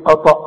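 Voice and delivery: "أطأ" with thick emphatic T; wrong heavy ta. Shown as a demonstration. Teacher echoes pupil's wrong reading, heavy ta. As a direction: say "أطأ" heavy, imitating the mistake. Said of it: "وتقول لي ايه".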